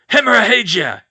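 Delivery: loud, high spell cry; curse shout. Acted. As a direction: slash the curse out. Villain casts again.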